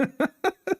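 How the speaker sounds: a squealing burst of laughter; very high laugh. Real, not acted. Streamer laughs about Patrick berating people, high squealing laugh.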